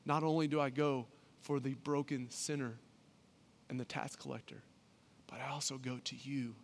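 soft, gentle Jesus voice; gentle invitation. Acted. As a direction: quietly invite them in. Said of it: "Here's what Jesus is telling them".

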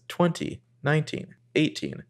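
quiet robot countdown voice. Retold or imitated. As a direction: count down like a car computer. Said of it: "a computer voice starts speaking".

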